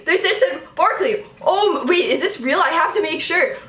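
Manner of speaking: high, loud, screaming cheer; ecstatic celebration. Real, not acted. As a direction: shriek with joy as your team lands its player. Celebrates favourite team's draft pick.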